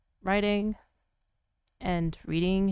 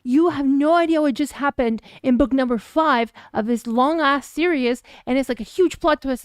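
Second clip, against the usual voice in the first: 1 excited retelling; 4 semitones higher, 7 dB louder than usual. Retold or imitated. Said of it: "I come to him and I'm like".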